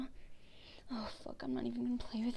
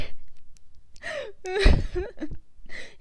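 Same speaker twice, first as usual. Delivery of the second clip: unhinged tearful laugh; high broken laugh; crying laugh; 9 semitones higher, 8 dB louder than usual. Acted. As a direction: laugh through sobs. Unhinged laugh after outburst.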